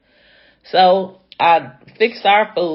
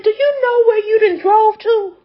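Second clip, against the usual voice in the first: high, loud cry of disbelief; shrill disbelief; 6 dB louder than usual. Retold or imitated. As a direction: shriek it at her, outraged. Scolding the driver in disbelief.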